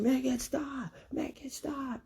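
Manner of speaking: high pleading shriek fading away; desperate scream. Retold or imitated. Captive villain begging Naruto to stop, high shriek. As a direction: beg in a high broken shriek. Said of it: "Mitsuki is just screaming".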